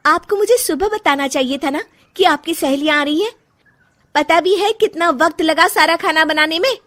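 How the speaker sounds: high, loud, indignant reproach; angry complaint. Acted. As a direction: take the mother-in-law to task. Daughter-in-law scolds mother-in-law.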